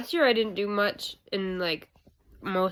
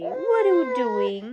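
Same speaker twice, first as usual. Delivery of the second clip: higher, slow, louder cooing question; 4 semitones higher, 6 dB louder, slower than usual. Real, not acted. Mom to baby.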